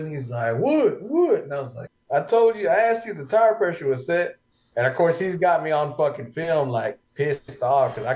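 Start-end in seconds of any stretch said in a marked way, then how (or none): 0.6-1.4 high sing-song teasing of his name; mocking taunt. Buddy teasing the angry driver, sing-song mocking. Friend taunting him on film. 2.1-4.4 annoyed retelling. Retold or imitated. Driver scolding Wood over tire pressure, exasperated. Retold blowup at friend Wood.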